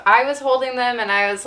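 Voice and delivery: ordinary talk, nothing marked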